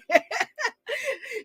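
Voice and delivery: a sudden high cackling laugh; real burst of laughter; high cackle. Real, not acted. Laughing at her own read.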